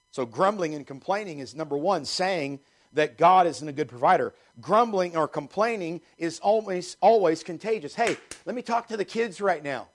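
ordinary talk, nothing marked

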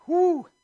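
high, delighted whoop; excited woo. Acted. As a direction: whoop like the feast is in front of you. Exclaiming over the food list.

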